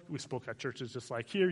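normal talking voice

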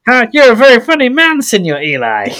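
mock Mexican accent, amused. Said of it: "he says it in a Mexican accent".

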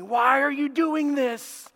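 high, slow, stretched-out plea; anguished confrontation. Acted. God challenging Balaam over the money, high and pleading. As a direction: draw the question out in rising exasperation.

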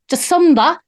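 "Jacinda" as "juh-SUN-da", Kiwi-style; put-on New Zealand vowel in "Jacinda"; Kiwi accent on Jacinda. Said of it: "going from Australian into K-, Kiwi".

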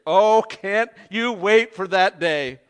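slow, loud, yearning question; eager longing. Real, not acted. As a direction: stretch it out with longing. Longing for heaven's day.

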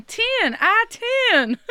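whole audience shouting the highway back at her; crowd yelling. Retold or imitated. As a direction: yell it like a whole crowd at a show. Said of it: "everyone's yelling".

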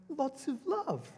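very high, slow put-on voice with a laugh; mum's sweet punchline. Mum explaining to her daughter, very high and slow with a laugh. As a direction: coo it innocently, laughing.

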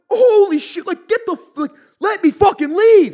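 high, very loud retold plea; acted panicked shout. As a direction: yell up at an attacker, re-enacting it. Acts his pleas from the floor.

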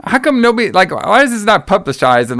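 high-pitched, gushing amazement; excited disbelief. Retold or imitated. Fan raving about a great wrestling moment, excited. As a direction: marvel at it, voice pitched well up. Retells own delighted reaction to segment.

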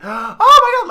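high, loud mock fan scream; fangirl squeal. Imitating Beatles fans on arrival, high loud mock squeal. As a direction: shriek like a starstruck teen.

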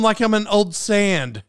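ordinary delivery

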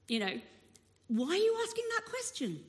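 high-pitched, baffled question; incredulous onlooker. Retold or imitated. Bystander questioning Jesus about a blind man, incredulous. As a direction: imitate a puzzled bystander, pitched right up.

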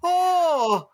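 high, hammed-up Irish brogue; mock Irish accent. Movie soldiers as Irish caricatures after their gold, in a high, hammy Irish brogue. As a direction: play a cartoon Irish treasure hunter. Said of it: "they're all like".

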